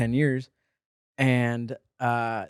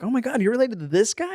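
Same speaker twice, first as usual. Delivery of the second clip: retold amazement; 9 semitones higher than usual. Retold hosts amazed at caller's relative.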